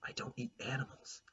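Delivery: soft unvoiced whisper of a shy girl. A narrator's reading. Olive quietly tells Clementine she skips meat, whispered. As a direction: Olive breathes it out. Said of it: "I said".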